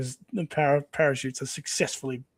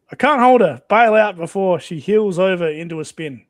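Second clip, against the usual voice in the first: much louder, higher urgent shout; shouting pilot; 4 semitones higher, 9 dB louder than usual. Acted. Pilot Bill yells to crew to bail out, shouting. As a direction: yell it over a failing engine. Pilot losing his plane.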